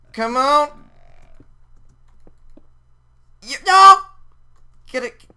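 high, loud exasperated yell; pleading that bursts into a yell; frustrated shout. Real, not acted. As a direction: cry out as it goes wrong. Tiger will not enter cage.

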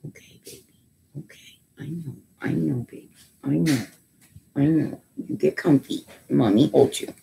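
hushed, tender soothing. Real, not acted. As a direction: comfort a frightened little one. Comforting a scared pet.

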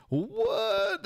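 high rising astonished what; surprised what. Real, not acted. Gamer startled by a magical fish, squeaks in surprise. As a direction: jump up in surprise.

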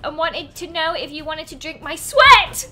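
mocking bully. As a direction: sneer it like a school bully.

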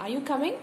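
yes-or-no question rising on "coming"; rising question intonation. Shown as a demonstration. Teacher models a yes or no question, rising expectant tune. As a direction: lift "coming" at the end, expecting an answer. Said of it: "ഒരു rising intonation".

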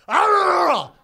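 loud high roaring growl; attacking dog snarl. Retold or imitated. Pit bull lunges at the news lady's face, imitated roaring snarl. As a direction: burst out as a lunging dog.

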